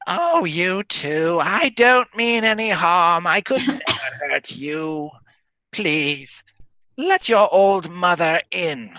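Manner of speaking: high, slow wheedling hag; sweet-talking hag. Acted. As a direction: coax like a sly old hag. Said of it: "and then she says".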